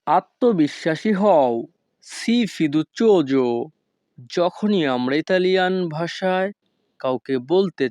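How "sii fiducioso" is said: slow, careful teacher pronunciation; very slow, deliberate Italian; slow Italian model phrase. Shown as a demonstration. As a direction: lay out each syllable slowly for the learner. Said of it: "তখন আমাদেরকে বলতে হবে".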